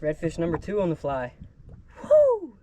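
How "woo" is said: a big celebratory yell, very high; high loud whoop. Real, not acted. Angler celebrating redfish number two, high loud whoop. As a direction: let out a victory whoop.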